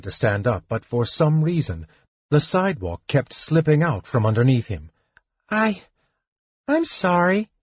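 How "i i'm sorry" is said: plain reading voice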